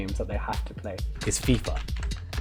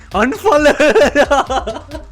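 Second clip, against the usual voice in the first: mock outraged shout; 12 semitones higher, 7 dB louder, much slower than usual. Joking outrage at the question.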